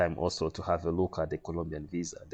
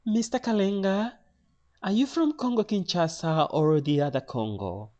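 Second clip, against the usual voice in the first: high-pitched querying clerk; high imitation of a Spanish official; Spanish lady official; 12 semitones higher, 3 dB louder than usual. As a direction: question him in the lady's high voice. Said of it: "another lady asks in a Spanish accent".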